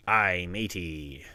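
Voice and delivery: slow, loud pirate punchline. Pretend. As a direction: drag out the pirate pun. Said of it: "What does a pirate say".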